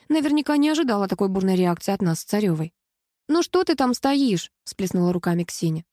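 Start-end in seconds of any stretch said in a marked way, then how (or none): 3.3-4.5 high, drawn-out complaint; impatient call. A narrator's reading. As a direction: urge a friend out, slightly exasperated. Ксеня нетерпеливо зовёт подругу из кабинки.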